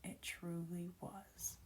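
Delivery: slow, tender whisper; near tears. A narrator's reading. Emma bidding Nesta farewell, whispered and tender. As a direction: let it fade into a hushed whisper. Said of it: "looked like she might cry now".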